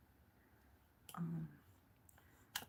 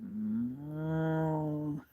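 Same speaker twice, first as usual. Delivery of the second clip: mooing like a cow; 7 dB louder than usual. Retold or imitated. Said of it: "They were like".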